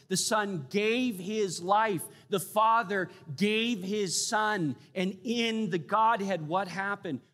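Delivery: high, loud and urgent preaching, slowing on key lines; preaching at full force. Acted. Preacher to congregation on the Father and Son, full-force preaching. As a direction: drive it home like a pulpit climax, stretching the last phrases.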